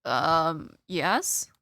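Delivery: ordinary talk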